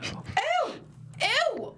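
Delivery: a very high, squealing ew of disgust. Acted. Finding a dildo in the box, shrieking in disgust. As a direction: recoil with a shrieked ew.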